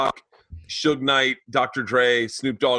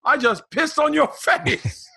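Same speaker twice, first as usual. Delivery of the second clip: much higher, slow, cheery British voice; Eddie Izzard impression; 3 dB louder, slower than usual. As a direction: play a chipper Brit delighted with himself. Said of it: "Eddie Izzard walks up and goes".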